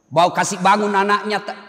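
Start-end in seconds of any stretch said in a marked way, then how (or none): none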